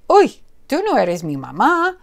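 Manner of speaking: high startled bird voice. Acted. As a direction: jump back in alarm.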